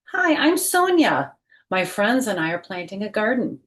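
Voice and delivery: lively picture-book read-aloud voice; reading to children. Acted. Teacher reading a picture book to her class, lively read-aloud. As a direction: read it out like story time for little kids.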